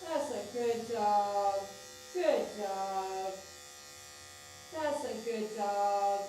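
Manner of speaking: slow, drawn-out crooning praise; soothing praise. Real, not acted. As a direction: stretch each word out to calm the dog. Praising the dog for staying calm.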